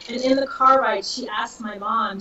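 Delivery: normal talking voice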